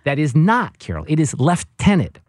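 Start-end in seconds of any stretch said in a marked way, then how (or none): 1.1-2.2 "lieutenant" said "leftenant" in a mock British accent; mock English accent, "leftenant". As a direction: correct her with a British "leftenant". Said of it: "make fun of him".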